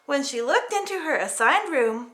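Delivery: ordinary delivery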